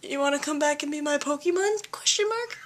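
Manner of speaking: sheepish plea. Retold or imitated. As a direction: ask hopefully, knowing it won't work. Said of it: "you're like".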